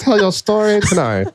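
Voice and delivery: high-pitched storytime librarian; put-on, playful librarian lilt. Shown as a demonstration. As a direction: announce it like a hammy librarian. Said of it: "My librarian voice?".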